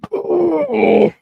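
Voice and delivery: high strained grunt of effort. Real, not acted. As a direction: grunt as you push something. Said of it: "here I am grunting and wheezing".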